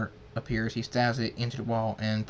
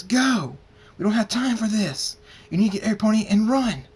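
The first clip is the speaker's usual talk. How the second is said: high, loud shouted orders; full, raised urgent command; urgent shouting; 11 semitones higher, 9 dB louder than usual. Acted. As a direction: yell at them to flee.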